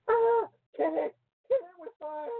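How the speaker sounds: high shrieking panic; panicked shriek. Real, not acted. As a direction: scream it at the creepy picture. Shrieks at a picture.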